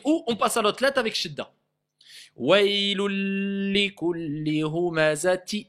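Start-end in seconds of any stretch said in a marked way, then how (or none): 2.3-5.7 "humazati" ending on i, no nun, slow; slow recitation. Shown as a demonstration. Teacher recites slowly to show the nun dropped. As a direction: chant it slowly, stop on i. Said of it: "J'ai dit i, stop, je dis pas in".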